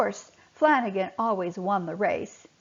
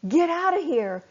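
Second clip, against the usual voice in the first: high, loud urgent shout; shouted warning to flee the turtle; 8 semitones higher, 5 dB louder than usual. Acted. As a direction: yell at them to flee. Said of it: "he shouted".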